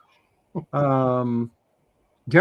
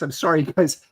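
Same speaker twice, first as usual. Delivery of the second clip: usual speaking voice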